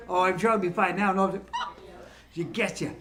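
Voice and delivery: usual speaking voice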